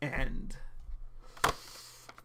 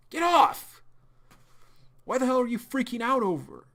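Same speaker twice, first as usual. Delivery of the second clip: much higher, loud angry outburst; furious shout; 10 semitones higher, 8 dB louder than usual. Acted. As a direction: yell at him to let go. John yanks free, furious.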